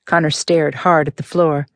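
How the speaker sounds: ordinary delivery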